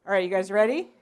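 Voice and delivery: usual speaking voice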